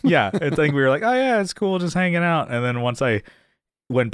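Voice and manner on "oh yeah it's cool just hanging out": high, easygoing imitation of being cool with it; mock carefree. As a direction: play it breezy, pitched well up. Retelling their carefree past attitude.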